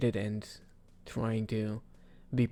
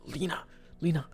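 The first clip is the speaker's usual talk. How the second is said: excited; 7 semitones higher, 8 dB louder than usual. Retold or imitated. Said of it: "very excited to be like".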